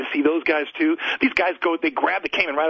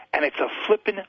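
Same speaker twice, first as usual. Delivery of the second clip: normal talking voice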